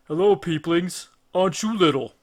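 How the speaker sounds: a loud, booming giant's voice, amused. Acted. Cyclops greeting the tiny trapped Greeks, booming and amused. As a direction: loom over tiny men and mock them.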